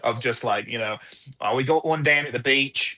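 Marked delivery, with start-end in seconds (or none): 1.4-3.0 imitated Aussie beach lifeguard; mimicked Australian accent from a TV show. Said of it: "lifeguard show that takes place in Australia".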